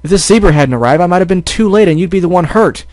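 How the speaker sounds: angry shouting. Acted. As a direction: shout it, hurt and furious. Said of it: "couldn't stop himself from shouting".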